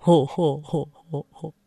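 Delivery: deep amused ho ho ho; low chuckle. Acted. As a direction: grandfather chuckling at the frog. Philémon laughs again.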